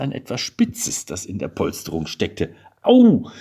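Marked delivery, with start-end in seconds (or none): 2.8-3.3 sudden high, loud ouch; cry of pain. A narrator's reading. Man pricks his hand in the upholstery, cry of pain. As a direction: yelp as if pricked. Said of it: "rief er".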